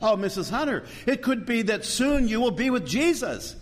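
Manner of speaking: mock earnest cheerfulness; naive eager reply. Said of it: "naively replied".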